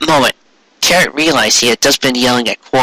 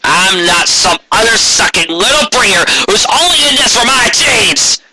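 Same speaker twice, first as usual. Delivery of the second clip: higher, furious roar; 9 semitones higher than usual. Acted. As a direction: roar it back at him. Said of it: "he roared".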